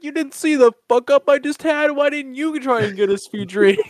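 answering the buddy, laughing. Retold or imitated. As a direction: protest while laughing. Own retort retold, laughing.

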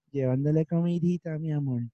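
lightly mocked cutesy lover voice; hablando meloso. Mocking cutesy talk to lovers. (tono ñoño de enamorado, en broma)

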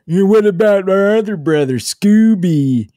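high-pitched goofy brother voice; Ben and Jerry character. Acted. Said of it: "It's Ben and Jerry".

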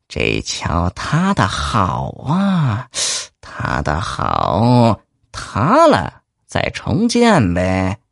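slow, sly scheming drawl; sly gloating. Acted. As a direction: savor each word like a schemer. Corrupt official gloats slowly.

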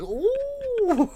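very high drawn-out ooh; a cartoonish coo of surprise; imitating diners cooing over the oven; mock surprise. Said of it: "they're like".

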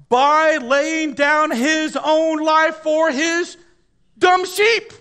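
slow, high, loud stretch; slow building shout. Acted. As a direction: draw it out and lift it to a cry. Preacher building to climax.

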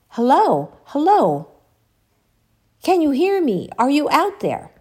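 retold woman's voice calling out to any listener; imitated female voice. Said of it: "this female voice was saying".

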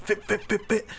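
quick high "fit, fit, fit" noises; stabbing sound effect. Retold or imitated. Acting out poking the rival with a blade, high stab noises. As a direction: spit out the stab sounds fast.

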